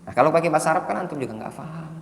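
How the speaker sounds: ordinary talk, nothing marked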